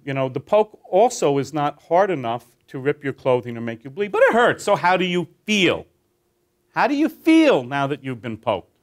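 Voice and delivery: normal talking voice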